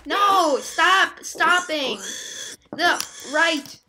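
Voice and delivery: loud, high alarmed yelling; real panicked shouting at a runaway character. Real, not acted. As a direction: yell at the game to stop. Character walks off a ledge.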